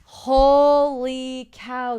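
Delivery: slow, loud, high stunned exclamation. Real, not acted. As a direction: drag it out in shock. Reacting to the plot twist.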